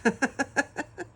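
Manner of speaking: a high, loud giggle; tickled by the bonsai joke; laughing. Real, not acted. Recalling her son rolling his eyes, a high laugh.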